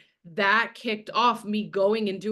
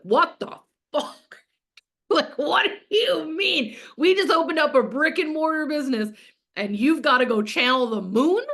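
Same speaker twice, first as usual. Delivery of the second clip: husband's shocked reaction; 5 semitones higher, 4 dB louder than usual. Retold or imitated.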